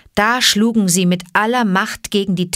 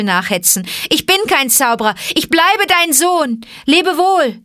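high, raised voice calling out; urgent farewell call; 6 semitones higher, 3 dB louder than usual. Acted. Mowgli sending Messua away from flying stones, calling urgently. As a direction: call it urgently over the danger.

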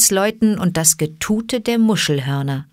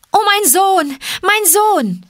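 very high, loud mother's wail; anguished cry; 14 semitones higher, 4 dB louder than usual. Acted. Messua pushing through the herd to her son, crying out. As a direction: cry out for your child in anguish. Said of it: "rief sie".

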